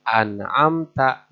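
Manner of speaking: an'amta slow with the n distinct; careful recitation; clear nun. Shown as a demonstration. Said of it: "harus jelas".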